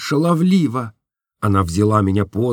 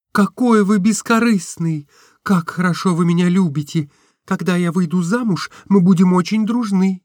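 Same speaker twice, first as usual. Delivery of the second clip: put-on higher girlish voice, eager; Nastenka's voice; 9 semitones higher than usual. A narrator's reading. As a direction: gush to your friend, a little naive. (высокий голос Настеньки, восторженно)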